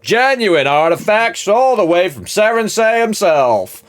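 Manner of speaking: high, loud, slow barker's pitch; market hawker call. Acted. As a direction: sell it like a fairground barker. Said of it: "someone going".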